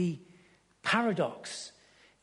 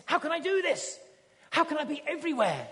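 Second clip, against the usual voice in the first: higher, mock-frantic question; panicked Bruce; 9 semitones higher, 3 dB louder than usual. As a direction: play the overwhelmed new God, lightly. Bruce voiced in film retelling.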